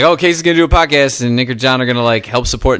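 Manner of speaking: mock plan. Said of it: "and it was like".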